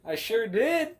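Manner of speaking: proud. Real, not acted. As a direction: boast it out loud. Proudly admits the dare.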